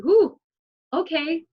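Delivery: excited whoop. Retold or imitated. Excited inner voice noticing signs.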